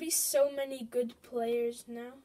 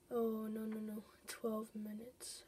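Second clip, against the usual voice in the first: ordinary talk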